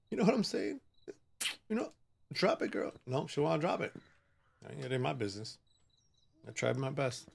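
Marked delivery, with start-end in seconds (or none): 0.1-2.9 whispered, pleading; whispered plea. Real, not acted. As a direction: whisper it to her. Hushed plea to the girl.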